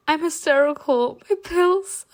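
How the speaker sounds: high wail breaking into sobs; fake hysterical crying. Narrator improvises hysteria for the groom, high theatrical wailing. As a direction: wail in mock hysteria, ending in shrieky sobs. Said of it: "started crying loudly".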